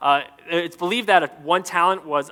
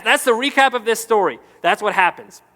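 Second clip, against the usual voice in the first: ordinary talk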